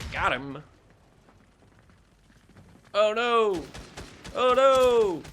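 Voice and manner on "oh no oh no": high, loud "oh no" rising in alarm; real panic. Real, not acted. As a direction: cry out as the fight turns bad. Game panic as enemy approaches.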